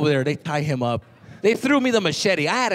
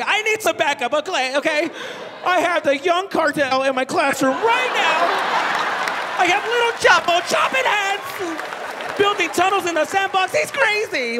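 panicked teacher; 11 semitones higher than usual. Retold or imitated. Teacher calling office about the cartel kid, panicked. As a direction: beg for backup in a panic.

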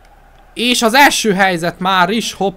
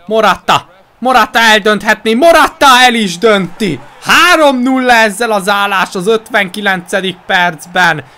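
excited Hungarian sports commentary; 4 semitones higher, 6 dB louder than usual. Real, not acted. Third goal commentary.

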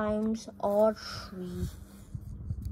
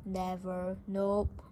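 plain reading voice

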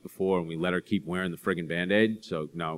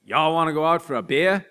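put-on Southern accent; 9 semitones higher, 8 dB louder than usual. Back home from Florida, mock Southern accent with a laugh. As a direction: ham up a college kid's new Southern accent. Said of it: "schizophrenic accent".

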